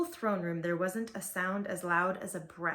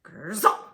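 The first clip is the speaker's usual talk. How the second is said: arrow whoosh; 8 dB louder than usual. A narrator's reading. Arrow sound.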